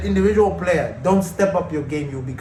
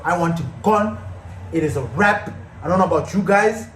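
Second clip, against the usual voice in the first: normal talking voice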